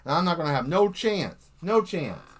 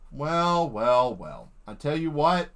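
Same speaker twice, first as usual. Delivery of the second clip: usual speaking voice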